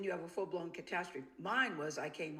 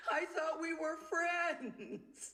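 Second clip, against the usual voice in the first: much higher and very slow, a hurt whimper; slow pitiful plea; 9 semitones higher, much slower than usual. Retold or imitated. Wife repeating her words to the friend, slow and pitiful. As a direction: stretch it out, heartbroken. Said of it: "It sounded so pitiful".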